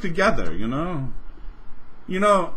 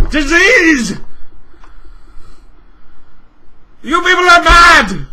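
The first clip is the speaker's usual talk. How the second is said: loud, higher yelling; outraged shout; 5 semitones higher, 6 dB louder than usual. Real, not acted. Scolding Microsoft about tap water, outraged shout. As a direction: hammer them with fury.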